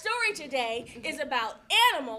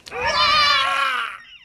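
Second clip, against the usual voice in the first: loud high burst of laughter; mixed pose laugh; 8 semitones higher, 8 dB louder than usual. Pretend. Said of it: "smush 'em together".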